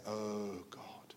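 breathless whispered plea; begging whisper. Retold or imitated. Sinner begging God for mercy, whispered. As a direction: whisper it like a desperate prayer.

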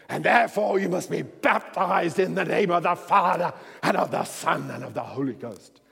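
loud booming preacher voice. Retold or imitated. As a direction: preach it out big. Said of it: "he starts with this deep, big voice".

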